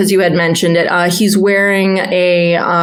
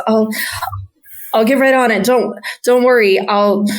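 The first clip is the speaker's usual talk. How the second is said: put-on higher, hesitant young voice; shy stammering stable boy; 5 semitones higher than usual. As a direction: stumble over the words nervously. Shy stable boy answers guards.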